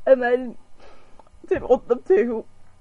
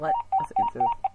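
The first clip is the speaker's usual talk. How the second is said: silly squeak; faster than usual. Pretend.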